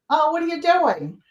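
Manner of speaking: high, chatty Boston voice; Boston accent. Retold or imitated. Dog buyer chatting on the phone, imitated Boston accent. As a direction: imitate a Boston woman making small talk. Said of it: "with her Boston accent".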